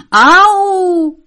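high, loud yelp of pain; devil's screech of pain. A narrator's reading. Devil woken by a pulled hair, screeching in pain. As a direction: shriek as the hair is torn out. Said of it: "zavřeštěl čert".

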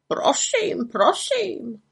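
high, huffy raven voice; offended. A narrator's reading. Raven rebuffed by the witch, offended. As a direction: squawk it in a high sulk. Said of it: "urazil se Abraxas".

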